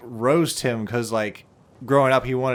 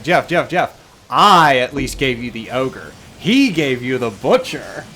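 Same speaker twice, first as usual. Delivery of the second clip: loud, slow mock one-upmanship; competitive bickering; 6 dB louder, slower than usual. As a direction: lean hard into the rival claim. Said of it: "who insulted Jeff more".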